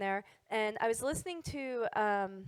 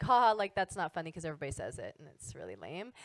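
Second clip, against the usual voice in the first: unimpressed mailman; 6 semitones lower, faster than usual. Retold or imitated.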